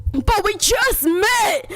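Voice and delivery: slow pleading prince. A narrator's reading. Prince to Cinderella, slow and pleading. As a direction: stretch it out, gently begging. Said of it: "said the prince".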